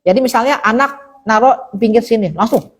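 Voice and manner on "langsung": normal talking voice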